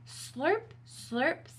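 high, rising tasting slurps; playful rising sound words; questioning slurps. Acted. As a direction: draw each word upward as if sampling soup. Acting out Max slurping his soup.

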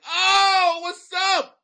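loud, high, excited shout-out; whooping hello; excited greeting. Real, not acted. Greets fan from Granny Lake.

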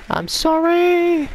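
very high, drawn-out squeal; squealed mock apology. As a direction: squeak out a loud, slow apology. Mock apology to game opponent.

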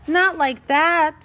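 slow, loud, exasperated groan. A narrator's reading. Stapler scolds Scissors, groaning. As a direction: drag it out high and exasperated. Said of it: "Stapler groaned".

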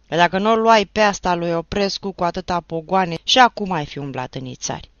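loud, defiant taunt; fearless shout. Acted. The man in the road taunts the teacher, shouting defiantly. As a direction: shout it without fear. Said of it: "strigă omul din drum, fără teamă".

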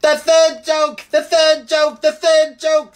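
high, loud, squawking bird; excited jackdaw. A narrator's reading. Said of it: "said the jackdaw in great excitement".

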